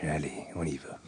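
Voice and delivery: breathy hushed radio whisper; low morning voice sinks to a whisper. Pretend. Fake radio host hushes.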